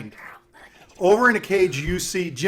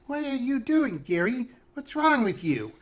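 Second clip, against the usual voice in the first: high, singsong, wheedling witch; pleading witch; 8 semitones higher, slower than usual. Acted. Witch appeals to the player who hit her, high wheedling. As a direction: protest innocently in a high witch voice.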